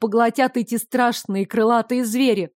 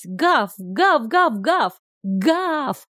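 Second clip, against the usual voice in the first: volley of mock barks; 2 semitones higher, much slower than usual. A narrator's reading. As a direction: imitate a dog yapping away. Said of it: "залилась".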